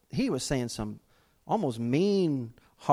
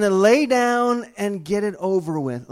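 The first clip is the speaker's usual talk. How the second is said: louder, higher repeat of the punchline; preaching it loud; 5 semitones higher, 7 dB louder than usual. Retold or imitated. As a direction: drive the punchline home. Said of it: "repeats punchline louder".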